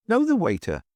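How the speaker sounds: high-pitched indignant echo; affronted, incredulous question. A narrator's reading. Chairman answering the hotel keeper, indignant. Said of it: "answered Mister Audley indignantly".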